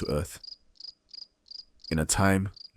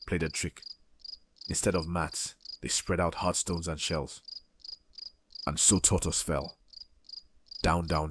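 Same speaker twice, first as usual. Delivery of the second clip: ordinary delivery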